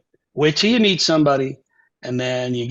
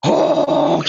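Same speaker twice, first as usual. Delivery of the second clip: imitated saxophone honk. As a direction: blurt a long horn honk. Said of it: "So I'm like".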